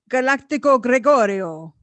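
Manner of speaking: playful put-on Italian; mock Italian accent. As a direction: announce the name like a hammed-up Italian. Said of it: "I had to do my Italian".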